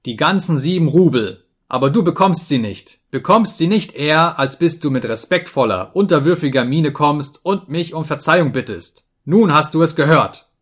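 loud, insistent scolding; angry ultimatum. A narrator's reading. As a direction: lay down the law in a temper. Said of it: "schrie ich ihn an".

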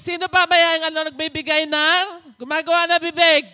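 booming, exasperated divine rebuke; God rebuking loudly. Acted. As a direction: thunder at Moses like an impatient God. Preacher voices God scolding Moses. (malakas at naiinis na tinig ng Panginoon)

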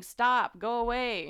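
loud, high shout at a bear; mock scream at the attacker; retold scream. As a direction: scream to drive it off. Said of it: "she's heard screaming".

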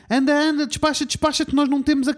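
hammed-up delivery guy hurrying his mate; imitating a rushed shout from the van; retold yell. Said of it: "começou a gritar".

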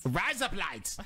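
put-on Australian voice; Australian accent. Shown as a demonstration. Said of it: "razor blades in Australian".

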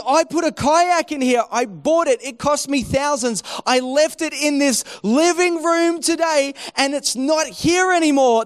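high-pitched, agitated retold protest; hammed-up anxious outburst; worked up. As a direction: imitate a man building a frantic complaint. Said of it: "He was all worked up".

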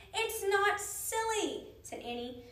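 high, loud, slow emphatic protest; indignant retort. A narrator's reading. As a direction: stretch it out insistently. Annie argues back at the woman.

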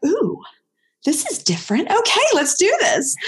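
high, bright-eyed eager dog voice; dog's excited voice. Retold or imitated. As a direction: voice the thrilled dog.

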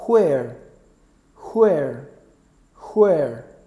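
each "where" starts with an aspirated h, "hwhere"; "where" as hweh-r, said for learners to repeat; HW pronunciation. Shown as a demonstration. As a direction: model "where" with h sounded before the w, falling tone. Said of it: "otra pronunciación que sería where".

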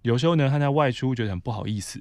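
usual speaking voice

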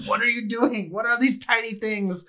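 hammed-up confused questioning; baffled victim. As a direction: act out someone puzzled by tiny attackers. Acts out baffled victim of kobolds.

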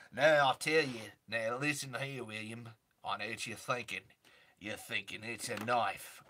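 low, drawling Aussie outback voice; Australian bushman impression. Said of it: "oh, you weren't asking for an impression".

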